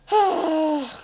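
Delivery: long breathy sigh. Real, not acted. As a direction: let out a big sigh. Giving up on the search.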